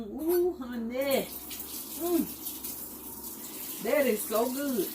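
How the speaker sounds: high, delighted tasting. Real, not acted. Tasting the ranch.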